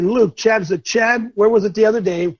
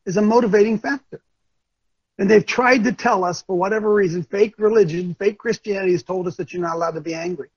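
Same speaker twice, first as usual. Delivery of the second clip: usual speaking voice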